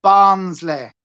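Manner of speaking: ordinary delivery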